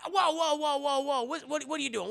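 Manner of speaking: high-pitched alarmed stammering; mock panic. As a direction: imitate a panicked bat trying to stop someone. Bat panics at the switch.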